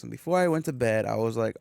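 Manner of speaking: ordinary talk, nothing marked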